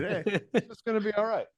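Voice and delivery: very high, airy self-reassurance; it's-all-fine falsetto; mock reassurance. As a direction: reassure yourself in a put-on high voice. Acting out his soundcheck relief.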